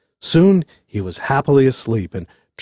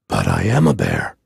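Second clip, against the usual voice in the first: very low bear voice; insistent bear; 11 semitones lower than usual. A narrator's reading. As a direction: insist in a deep, meek bear's voice.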